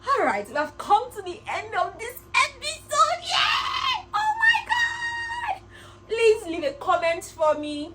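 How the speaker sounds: very high and loud, shrieking with joy; yay it out like a celebration; ecstatic outro. Real, not acted. Vlogger ending the episode, squealing yay.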